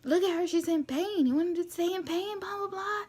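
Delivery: high, loud imitation of pleading outrage; hammed-up scolding that pitches right up; heated arguing. Mom telling off the husband over her daughter's pain, heated.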